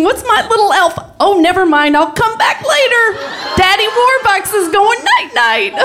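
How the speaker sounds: hammed-up drunk man giving up at the door; drunken calling. Drunk roommate calling through the door, then leaving. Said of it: "he's knocking".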